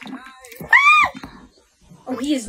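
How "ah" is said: sudden high shriek of shock; shocked scream. Acted. A startled scream at the mirror. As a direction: scream on seeing her face.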